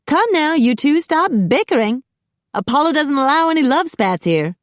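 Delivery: high, theatrical scolding; fussy chiding in a high character voice; chiding salon host. A narrator's reading. Macy frowns back at two bickering guests, high chiding. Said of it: "glanced back with a frown".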